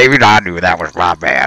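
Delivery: ordinary talk, nothing marked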